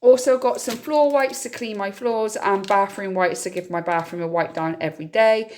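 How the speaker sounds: ordinary talk, nothing marked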